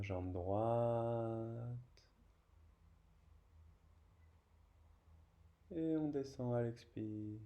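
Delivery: slow, low voice with drawn-out final syllables; unhurried yoga instructor leading the breath; yoga guidance. Real, not acted. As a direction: guide each breath, stretching the last word. Guides slow breathing and leg lifts.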